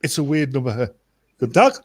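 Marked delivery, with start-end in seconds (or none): 1.4-1.7 mock German phone greeting; German accent. Shown as a demonstration. Prankster picks up a weird number, mock German accent. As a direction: answer in a put-on German accent. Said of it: "mine isn't Russian".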